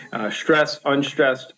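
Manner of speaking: ordinary delivery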